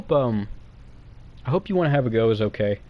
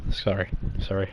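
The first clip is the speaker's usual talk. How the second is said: embarrassed sorry; 5 semitones lower, 7 dB quieter than usual. Real, not acted. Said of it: "I do that when I'm nervous".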